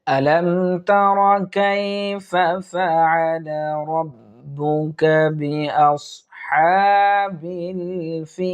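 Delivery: slow, melodic tajweed recitation; measured Quranic chanting with long pauses. Acted. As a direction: chant each word long and drawn out. Recites Surah Al-Fil.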